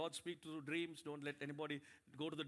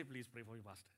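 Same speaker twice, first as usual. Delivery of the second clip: meek plea; 7 semitones lower, 9 dB quieter, faster than usual. Retold or imitated. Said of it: "they say".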